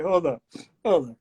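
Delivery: ordinary delivery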